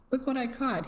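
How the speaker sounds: husband back from fishing calling up to the house, calling out. Acted. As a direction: call it up to the terrace. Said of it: "he called out".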